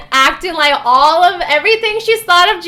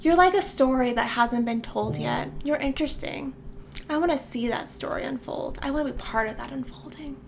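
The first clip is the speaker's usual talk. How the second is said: much softer, lightly voiced romantic line; hint of intimate murmur; 10 dB quieter, faster than usual. Locke to Jude, a hint of soft romance. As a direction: murmur it like a half-mocked confession. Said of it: "looks into her eyes and says".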